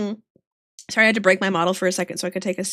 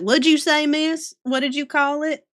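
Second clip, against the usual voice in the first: put-on higher, puzzled Southern-accented kid; lightly mimicked Southern kid's question; imitated Southern kids; 4 semitones higher than usual. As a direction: ask like a little Southern child. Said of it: "my little Southern kids will be like".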